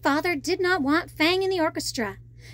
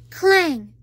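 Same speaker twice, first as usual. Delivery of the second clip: usual speaking voice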